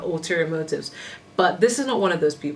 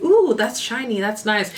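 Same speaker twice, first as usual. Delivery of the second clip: put-on childish voice; 5 semitones higher than usual. Reader imitating a childish person, higher wide-eyed kid voice. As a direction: play a dazzled little child. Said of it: "someone who's childish".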